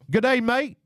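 playful put-on Australian accent; English-Australian accent. Shown as a demonstration. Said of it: "It's all an English accent".

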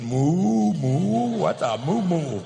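loud, drawn-out mock puzzlement; baffled young people. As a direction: stretch out each "moo" as clueless youngsters would. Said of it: "all the young people are going".

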